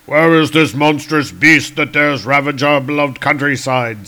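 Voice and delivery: lightly voiced blustering warrior; a hint of hearty bravado; Volstagg. Volstagg asking comrades about the beast, mildly blustering. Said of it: "says Volstagg".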